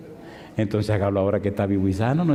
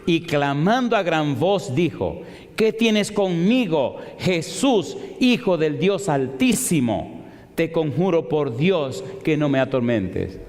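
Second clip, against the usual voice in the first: slow, raised Bible reading; slow scripture reading; 4 semitones higher, slower than usual. Acted. Preacher reading the demon's words to the church, slow. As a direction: draw out the demon's cry.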